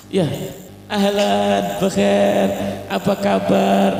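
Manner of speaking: high, drawn-out sing-song welcome; cheerful melodic hello; smiling greeting. Acted. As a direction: greet warmly through a smile, stretching the words.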